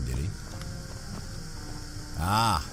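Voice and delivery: ordinary delivery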